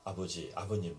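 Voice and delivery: a grave, formal son addressing his father; mock-solemn address. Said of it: "근엄하게".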